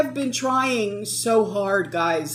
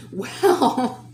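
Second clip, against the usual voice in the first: nonchalant; 5 semitones higher than usual. Retold or imitated. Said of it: "so nonchalantly".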